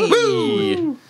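high excited cheer; cheering woo. Acted. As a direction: whoop with delight.

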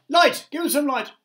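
very high, loud demand; crowd crying out. A narrator's reading. Trapped creatures demanding light from the glowworm, crying out. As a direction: cry out sharply for help. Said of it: "they cried".

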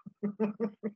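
short amused laugh. Real, not acted. Lecturer joking to students about being the boss, laughing. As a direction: chuckle at your own joke.

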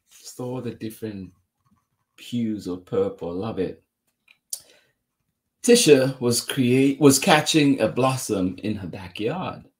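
ordinary delivery, nothing marked

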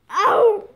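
pained yelp. Acted. Patient cries out.

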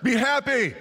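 high, loud, slow parent yell; mock yell at the kids. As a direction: yell at the kids to be happy on Easter morning. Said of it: "You're yelling at the kids".

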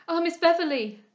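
loud, high, bursting out; impassioned cry. A narrator's reading. Henrietta can no longer restrain herself, crying out. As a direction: cry out, unable to hold back. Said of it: "cried Henrietta".

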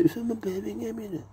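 high, soft mock Brummie; whisper-light Birmingham impression; hint of a Birmingham accent. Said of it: "them say".

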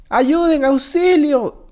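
high, drawn-out playful cry for help; mock plea for help. Trapped player calls for rescue in mock distress. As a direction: wail for rescue, hammed-up.

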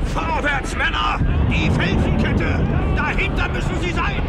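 rally the men forward at full voice; shouted command. Acted. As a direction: general bellowing orders on the charge. General commands the riders.

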